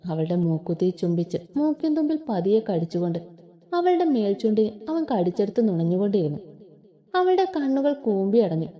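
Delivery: ordinary delivery, nothing marked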